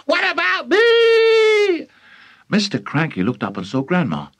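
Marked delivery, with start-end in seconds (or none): 0.1-1.9 indignant, very high screech; loud high old-woman wail; shouting granny. A narrator's reading. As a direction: screech for attention, stretching the end. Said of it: "Grandma shouted from the rooftop".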